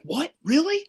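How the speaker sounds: high-pitched imitation of incredulous dad; mock astonishment, jumping up in pitch; surprised dad. Retold surprised dad reacting to news.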